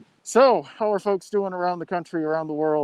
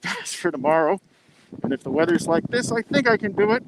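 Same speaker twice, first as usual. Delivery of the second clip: normal talking voice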